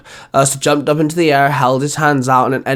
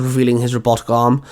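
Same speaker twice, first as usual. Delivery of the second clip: ordinary delivery, nothing marked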